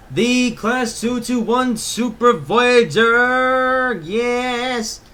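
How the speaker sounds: loud, slow, high showman reveal; excited hype. Pretend. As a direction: present it like a grand reveal. Hyping the new train.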